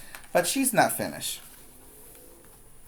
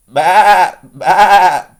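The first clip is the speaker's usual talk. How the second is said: loud, higher sheep bleats; bleating sheep; 5 semitones higher, 12 dB louder than usual. A narrator's reading. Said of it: "bleated the sheep".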